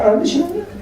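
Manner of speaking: high, baffled disbelief; dumbfounded. Retold or imitated. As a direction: gasp it out in disbelief. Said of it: "I was dumbfounded".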